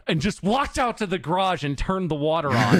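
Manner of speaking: usual speaking voice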